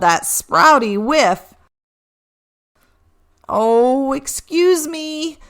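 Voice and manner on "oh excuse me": a little higher, fussy reindeer voice; sheepish reindeer, a touch embarrassed; mildly embarrassed Rudy; lightly voiced Rudy. A narrator's reading. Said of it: "said Rudy".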